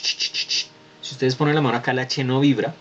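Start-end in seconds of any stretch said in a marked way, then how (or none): 0.0-0.7 whispered "ch, ch, ch, ch" with no voice; ch sound demo. Shown as a demonstration. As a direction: hiss out "ch" without vibration. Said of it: "que ustedes hacen ch". ("ch" susurrado, sin vibrar las cuerdas vocales)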